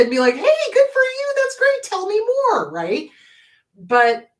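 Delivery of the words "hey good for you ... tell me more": very high, loud gushing praise; acted delighted encouragement; enthusiastic parent. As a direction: cheer a child on, pitch way up. Acts out supportive parent cheering kid.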